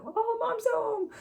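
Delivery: very high, slow excited dog voice; voicing the dogs. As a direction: squeak it like the happy dogs. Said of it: "Mine do it too".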